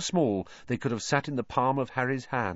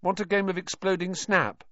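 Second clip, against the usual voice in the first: high-pitched, hopeful schoolboy; eager Neville; 10 semitones higher than usual. A narrator's reading. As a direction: invite a friend in a high, boyish voice. Neville invites Harry to play.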